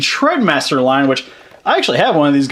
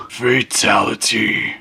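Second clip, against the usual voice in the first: low, slow announcer voice; fighting game announcer; 7 semitones lower, much slower than usual. Pretend. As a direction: boom it out like an arcade announcer. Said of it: "that announcer coming from".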